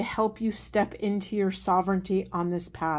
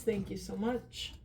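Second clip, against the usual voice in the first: much softer, murmured thanks to Spirit; 11 dB quieter than usual. Real, not acted. Reader thanking Spirit under her breath, softly. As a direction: breathe the thanks quietly, almost to yourself.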